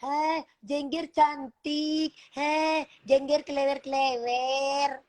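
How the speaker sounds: slow, sneering sing-song; mocking sing-song praise. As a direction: drag it out to mock her.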